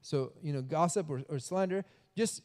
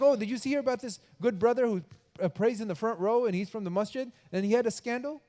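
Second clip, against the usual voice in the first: high, louder, breathless with news; excited gossip; 6 semitones higher, 4 dB louder than usual. Pretend. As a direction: spill the juicy scandal. Gossiper voiced, very eager.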